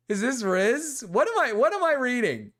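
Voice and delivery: high, loud incredulous ranting; aghast at what you're reading; disbelief. Real, not acted. Shocked by creepy message.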